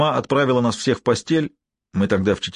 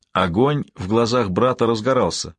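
ordinary delivery, nothing marked

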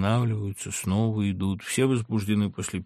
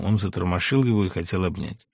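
usual speaking voice

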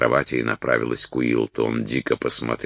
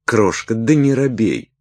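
teasing coaxing; 9 semitones higher, 4 dB louder than usual. A narrator's reading. Салли подначивает робеющего Уилта.

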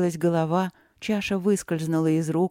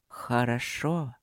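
low, slow voice; drawn-out low approval; 6 semitones lower, 4 dB quieter, slower than usual. Acted. Mystical voice approves.

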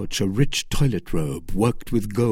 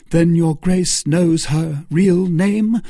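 slow, sharp, higher and louder challenge; 7 semitones higher, 6 dB louder, slower than usual. Acted. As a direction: press the duke hard, cutting each word. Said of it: "asked Felton in a sharp tone".

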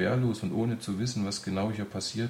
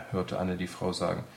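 ordinary delivery

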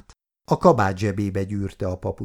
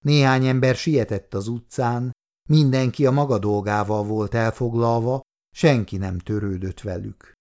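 ordinary delivery, nothing marked

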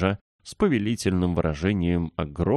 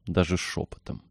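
usual speaking voice

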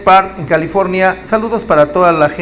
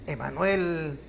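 ordinary talk

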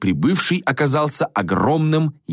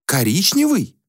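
high, puzzled echo of the word; a prince baffled by a colour; surprised repeat; 10 semitones higher than usual. Acted. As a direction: repeat it as if it's absurd. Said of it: "удивлённо повторил принц Аксиус".

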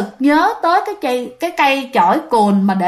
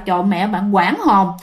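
usual speaking voice

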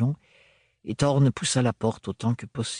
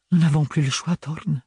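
sighed; 4 semitones higher than usual. A narrator's reading. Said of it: "soupira Bérénilde".

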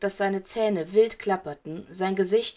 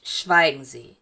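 lower, louder cutting order; stern command; 6 semitones lower, 6 dB louder, slower than usual. A narrator's reading. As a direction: silence him with cold authority. Teacher cuts off panicking boy.

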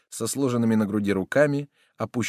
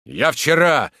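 raised, loud start of an account; agitated; 6 semitones higher, 8 dB louder, slower than usual. A narrator's reading. Soldier continuing his explanation, agitated. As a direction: press on in agitation.

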